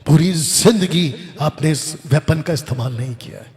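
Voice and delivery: ordinary talk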